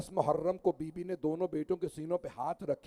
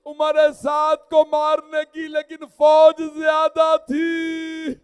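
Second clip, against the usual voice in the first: weeping farewell; 9 semitones higher, 15 dB louder, slower than usual. Acted. Dying boy sending last words to his mother, weeping into a sung wail. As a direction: let the weeping grow into a chanted lament. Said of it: "उन्होंने रो के कहा".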